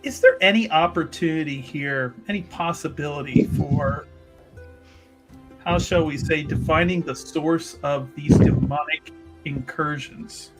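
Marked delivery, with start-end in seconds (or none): none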